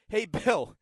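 mock British reporter. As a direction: send up the long-winded British guy. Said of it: "a very long British way of saying".

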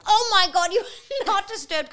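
a mum brushing it off with a laugh. Retold or imitated. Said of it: "She went".

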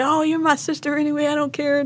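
high-pitched, quick, gushing imitation of a thrilled relative; imitating excited brother. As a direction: gush it fast and high, like an overjoyed brother. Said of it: "He was all excited".

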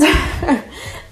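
short breathy laugh, falling; a wry real laugh; 1 rueful laugh. Real, not acted. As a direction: laugh it off in disbelief. Laughs at messed-up order.

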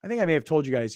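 ordinary talk, nothing marked

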